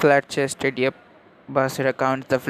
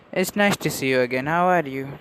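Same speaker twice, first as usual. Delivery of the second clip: ordinary delivery, nothing marked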